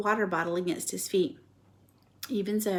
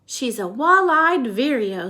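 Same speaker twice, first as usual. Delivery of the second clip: scientific; 4 semitones higher, 7 dB louder, slower than usual. Acted. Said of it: "said George scientifically".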